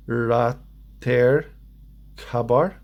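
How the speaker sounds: sounding out unfamiliar words slowly; slow reading. Shown as a demonstration. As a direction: read haltingly, word by word, with gaps. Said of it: "If I'm reading this slowly".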